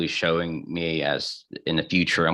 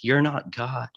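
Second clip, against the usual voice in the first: retold yell; 7 semitones higher, slower than usual. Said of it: "I, I yell".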